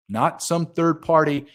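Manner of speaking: ordinary talk, nothing marked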